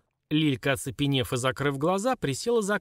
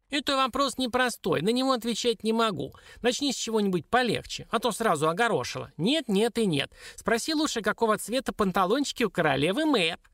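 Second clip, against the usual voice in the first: high, squeaky little dwarf; high-pitched, fussy refusal; evasive dwarf; 9 semitones higher than usual. Acted. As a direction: dodge the question in a tiny imp's voice.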